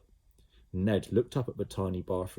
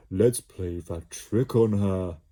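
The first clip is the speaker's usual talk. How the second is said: mischievous blob; 6 dB louder, slower than usual. A narrator's reading. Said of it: "Slime proposing trick".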